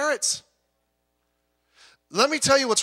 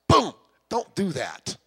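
mock wife scolding; slower than usual. Wife voiced in sermon.

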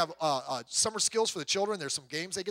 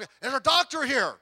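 raised, higher voice calling out to a crowd; calling for a doctor; 6 semitones higher, 7 dB louder than usual. Acted. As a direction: call out to the bystanders for help.

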